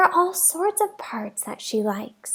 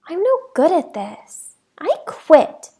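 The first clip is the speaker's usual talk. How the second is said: put-on frustrated girl; 3 semitones higher than usual.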